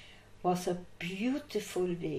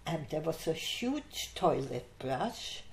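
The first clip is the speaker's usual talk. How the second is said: ordinary delivery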